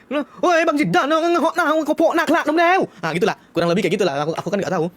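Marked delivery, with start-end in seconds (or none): 0.1-2.8 mock Padang babble. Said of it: "Aku ketawa-ketawa kayak".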